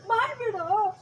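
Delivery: high, crying demand; excited. Shown as a demonstration. Director showing the actor a demand, excited high voice. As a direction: urge it high, close to tears. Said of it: "ವಾಯ್ಸಲ್ಲಿ excitement ಬೇಕು".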